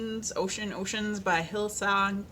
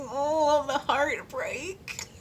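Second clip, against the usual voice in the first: higher, slow, hammed-up sigh; mock melodrama; 6 semitones higher, much slower than usual. Laughing at herself getting the feels, mock-dramatic sigh. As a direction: overplay the heartbreak for a laugh. Mocking her own feels at song.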